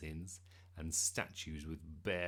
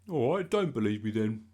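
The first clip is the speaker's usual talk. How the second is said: loud, offended huff; huffy; 3 semitones higher, 13 dB louder than usual. A narrator's reading. Bertie disbelieved by his friends, answering huffily. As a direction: throw it back in a huff. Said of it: "said Bertie huffily".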